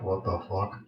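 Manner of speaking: breathy, barely voiced "what the fuck"; stunned hush. Number One stunned as cups shake, hushed disbelief. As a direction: gasp it out under your breath.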